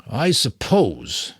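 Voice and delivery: drawn out slowly, thinking aloud. A narrator's reading. Mentor slowly pondering an idea, drawn out. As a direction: let each word hang as you ponder. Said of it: "he said slowly".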